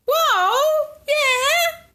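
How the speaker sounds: sudden bright exclamation; high whoa. Real, not acted.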